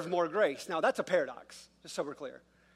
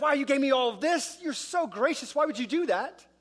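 higher, wide-eyed wonder; amazed gratitude; 7 semitones higher than usual. Acted. Adam and Eve receiving all creation from God, amazed. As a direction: marvel at an undeserved gift. Imagined grateful response to God.

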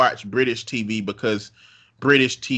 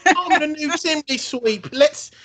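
high, hammed-up mock Cockney accent; 13 semitones higher, slower than usual. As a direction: ham up a fake Cockney chimney sweep, way up high. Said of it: "very specific bullshit ass version of British speak".

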